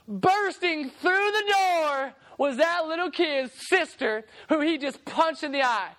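ordinary delivery

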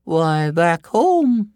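high, very slow, loud woman's voice; high-pitched storybook woman; village woman answering. Acted. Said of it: "said the other".

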